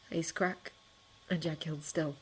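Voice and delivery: plain reading voice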